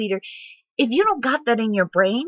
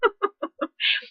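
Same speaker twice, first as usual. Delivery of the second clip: high laugh; 13 semitones higher than usual. Real, not acted. Laughs at the story.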